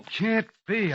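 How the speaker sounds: high, shocked denial; disbelief. Acted. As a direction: refuse to believe it. Shot man is alive.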